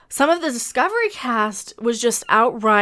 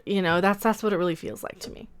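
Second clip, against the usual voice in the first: usual speaking voice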